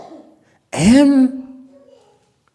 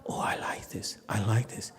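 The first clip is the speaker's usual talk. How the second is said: hushed contented quote; 3 semitones lower, 12 dB quieter than usual. Retold or imitated. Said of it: "he says".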